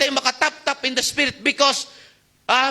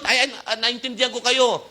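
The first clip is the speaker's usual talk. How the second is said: ordinary delivery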